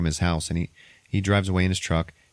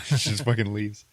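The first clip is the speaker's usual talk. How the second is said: normal talking voice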